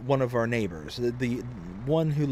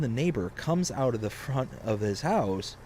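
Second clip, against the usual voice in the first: ordinary talk, nothing marked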